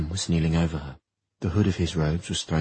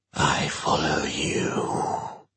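slow unvoiced demonic hiss; hissing whisper; 3 semitones lower, much slower than usual. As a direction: hiss it from below. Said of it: "hissed the voice".

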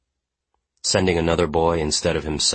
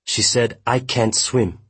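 higher-pitched, falling statement; agitated, raised-pitch statement; upset report; 7 semitones higher, slower than usual. A narrator's reading. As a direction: report it in distress, falling at the end. Gil reports the victim's words.

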